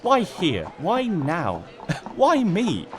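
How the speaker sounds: a pony bemoaning her misfortune, half laughing at the end. Acted. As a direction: whine to the heavens about your bad luck. Twilight lamenting her luck.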